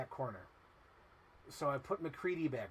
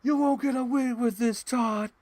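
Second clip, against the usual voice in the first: much higher and louder villain threat; cartoon threat; 10 semitones higher, 12 dB louder than usual. Quoting Fox and the Hound, a high loud threat. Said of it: "how they say it in Fox and the Hound".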